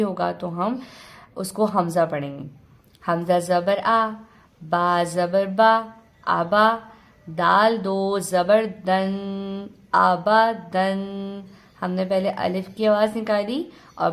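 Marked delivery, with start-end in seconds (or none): 3.0-11.0 slow, measured letter-by-letter recitation; spelling drill. Shown as a demonstration. Teacher spells out a word for learners, slow drilled recitation. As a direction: hold the final nasal syllable long. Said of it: "ہجے کی مشق بھی کریں گے".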